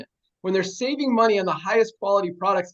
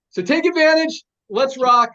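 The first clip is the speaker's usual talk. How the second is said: hype shout; 4 semitones higher, 6 dB louder than usual. Real, not acted. Hyping up team on call.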